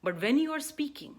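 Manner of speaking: ordinary talk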